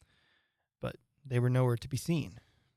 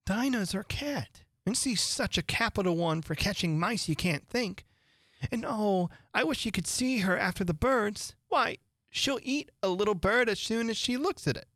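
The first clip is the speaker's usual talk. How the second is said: higher, enthusiastic praise; eager Alice; 7 semitones higher than usual. A narrator's reading. Alice tells the birds about her cat, higher and eager. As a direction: brag happily about a pet. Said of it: "Alice replied eagerly".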